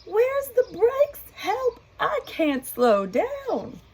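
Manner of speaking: very high, slow panicky cry; panicked cow. Acted. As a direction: squeal for help as she speeds downhill. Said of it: "Molly uttered".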